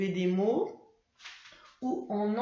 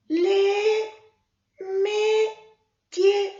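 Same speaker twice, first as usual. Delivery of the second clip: put-on much higher, louder, slow child puppet voice; Mimi; 11 semitones higher, 9 dB louder, much slower than usual. Mimi the puppet answering her teacher, high child voice. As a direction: answer slowly like an eager little puppet.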